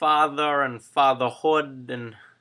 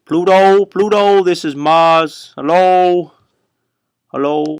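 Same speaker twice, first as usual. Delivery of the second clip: loud, raised mic-check call; 4 semitones higher, 13 dB louder than usual. Pretend. Hailing Pluto from Mars, loudly calling out. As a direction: call out as if hailing a distant planet.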